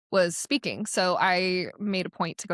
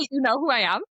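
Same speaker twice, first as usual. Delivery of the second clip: higher-pitched, amazed question; retold surprise; 6 semitones higher, 3 dB louder, faster than usual. Newcomer to athletes who know her name, mock amazement. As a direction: play up disbelief at being recognised.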